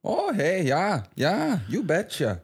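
put-on Minnesota accent with high swoops; Fargo accent. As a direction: answer like an agreeable Fargo local. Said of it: "you're still talking in the accent".

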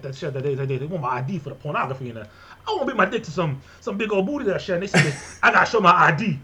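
high, loud Louisiana rant in thick dialect; Louisiana Cajun accent. Acted. Podcaster mimicking relatives' talk about ID laws, exaggerated Louisiana accent. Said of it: "I would do the accent".

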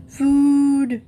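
loud, high bellow; bellowing cow. Acted. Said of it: "bellowed Arbutus".

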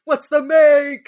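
put-on high, loud, slow old man yelling; imitating a grandpa yell. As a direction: bark it like a grandpa. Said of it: "grandpa yells".

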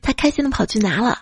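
ordinary talk, nothing marked

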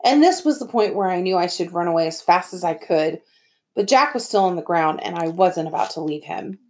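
ordinary delivery, nothing marked

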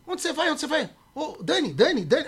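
urgent high-pitched mock calling; retold calling out. Friend calls after Dani as he walks off, retold calling out. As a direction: call after someone walking away.

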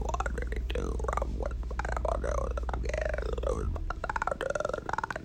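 low droning robot voice. Acted. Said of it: "trying my best to sound like a robot".

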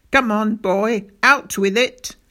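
loud, pressing order; parade-ground shout. A narrator's reading. Captain pressing silent pupil, shouting. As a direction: bark at him like a soldier on parade. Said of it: "He was shouting at me".

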